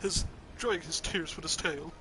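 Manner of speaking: ordinary talk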